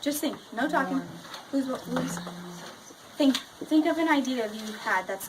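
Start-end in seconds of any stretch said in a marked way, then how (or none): none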